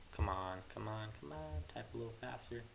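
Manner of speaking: quiet, under the breath; muttering to self. Real, not acted. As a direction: urge yourself on softly while typing. Muttering to self while typing.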